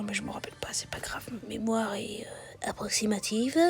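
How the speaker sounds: whispering to herself; whispered aside. Real, not acted. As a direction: drop to a secret whisper. Whispered aside about memory.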